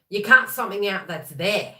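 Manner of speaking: ordinary talk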